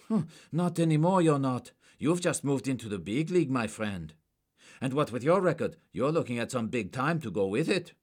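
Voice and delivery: high, loud and hard; menacing threat. A narrator's reading. Agent threatening a forger, loud and menacing. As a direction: press the threat home.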